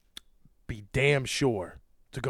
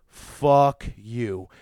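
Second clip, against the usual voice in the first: a louder barked curse to finish; angry shout; 9 dB louder than usual. Real, not acted. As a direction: spit it out loud. Ending the furious tirade.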